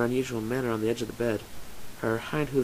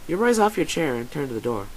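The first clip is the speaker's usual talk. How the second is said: plain reading voice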